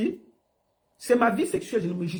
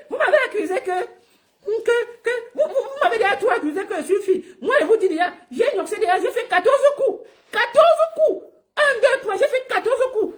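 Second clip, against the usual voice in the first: much higher, laughing mock imitation of a man with a thin voice; mocking his rival's high voice; 11 semitones higher than usual. Mocking the rival he calls Whoopi, in a much higher laughing voice. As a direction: parody his squeaky voice while cracking up. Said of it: "Ta voix est trop aiguë".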